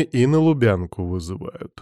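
ordinary delivery

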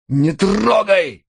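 very high, drawn-out cry; pained scream. A narrator's reading. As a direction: scream as the wound is touched. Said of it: "закричал Рой". (кричит от боли)

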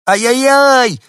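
very high, loud howl; desperate wail. A narrator's reading. Small dog on the balcony howls in desperation. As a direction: howl in despair. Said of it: "отчаянный вопль собачки".

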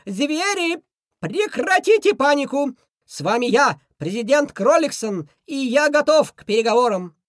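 high, loud shout to calm a crowd. Acted. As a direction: yell it with all your might. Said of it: "закричал, что есть мочи".

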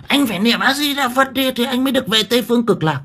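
high, pushy, nagging scold; bossy woman ordering the dying man to chant; shrill scolding old woman. Retold or imitated. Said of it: "bắt nó".

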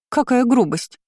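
high-pitched affronted exclamation; offended young lady's protest; indignant. A narrator's reading. Girl shoved aside by the captain, indignant. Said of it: "возмутилась ему в спину девушка".

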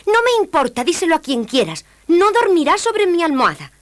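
high, loud angry princess. A narrator's reading. (princesa furiosa con voz aguda)

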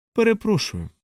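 high, caustically polite apology; a tart, barbed courtesy; caustic politeness. A narrator's reading. Rumfoord to Salo, with caustic politeness. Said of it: "з в'їдливою вічливістю".

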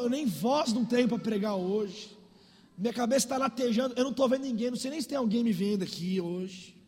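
ordinary talk

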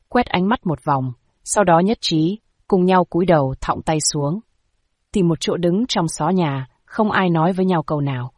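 plain reading voice

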